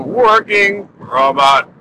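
slow, winding-down robot voice; robot powering down. Acted. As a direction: power down like a machine, slowly. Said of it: "when I say working robot powering down".

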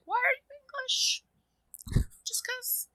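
mock squeaky question. Guest mocking an actor's accent, high squeak. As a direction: squeak the question like a baffled fan. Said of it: "it's like".

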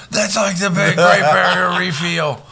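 high, loud mocking yell; loud harsh voice. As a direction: imitate a yelling friend, hammed up. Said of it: "This motherfucker's like".

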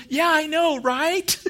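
high, loud, amused agreement. Retold or imitated. Verizon rep agreeing with the customer, imitated amused high voice. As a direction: imitate a chuckling, high-pitched reply. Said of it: "she just kinda laughed".

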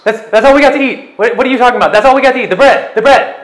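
high, loud baffled questions; a muddled lunatic who doesn't get it; confused madman. Acted. Lunatic confused about bread.

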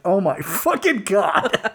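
slow, laughing disbelief; laughing exasperation. Retold or imitated. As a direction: drag it out while laughing. Stunned by absurd request.

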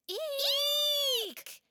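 high loud startled squawk; squawk of fright. Acted. Henny and Big Gauro startled by Chulur, squawking. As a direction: eek like a startled bird.

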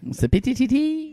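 high, slow, laughing leprechaun voice; a lightly voiced leprechaun chant. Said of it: "Sound like a leprechaun".

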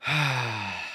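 long, low, soft sigh; heavy sigh. Real, not acted. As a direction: let out a long exhale. Sighs before pleading for the league.

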